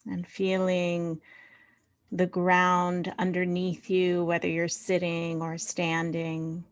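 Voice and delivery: unhurried meditation voice with long pauses; guided meditation. Real, not acted. Leader inviting the group to find body and earth, guided meditation. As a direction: settle them gently into body and breath.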